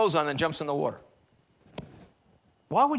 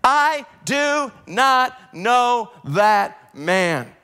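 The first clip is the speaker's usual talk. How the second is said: very slow, louder, hammered-out denial; swearing denial; 5 semitones higher, 7 dB louder, much slower than usual. Retold or imitated. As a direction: spit out each word as an oath. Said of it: "began to evoke a curse on himself and swear".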